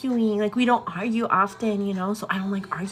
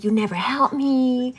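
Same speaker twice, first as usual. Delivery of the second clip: higher, drawn-out complaint; retold bickering; 4 semitones higher, 4 dB louder, slower than usual. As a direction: mimic a partner's drawn-out grievance. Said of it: "getting real ugly".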